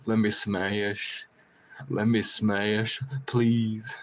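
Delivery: drawn-out begging crow voice; slow pleading crow. Retold or imitated. The crow pleads back at him, slow and drawn out. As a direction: stretch every word, a bird begging.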